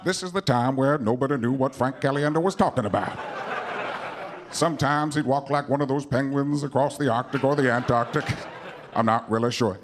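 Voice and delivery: low, measured narrator impression; Morgan Freeman. Famous narrator helping out the comic after a slip, low measured impression. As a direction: narrate the mishap as a solemn documentary. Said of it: "you'll need Morgan Freeman to help you out".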